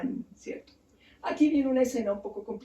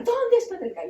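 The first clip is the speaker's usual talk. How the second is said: retold yell; 9 semitones higher than usual. Abandoned queen looks for Nergal, mild retold yell. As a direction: cry it out high without full volume. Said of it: "empieza a gritar".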